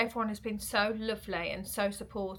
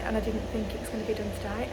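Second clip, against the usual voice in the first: usual speaking voice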